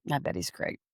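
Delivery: breathy half-whispered echo of bet he's great; whispered sarcastic echo. Real, not acted. Echoing the host's sarcastic joke, in a whisper.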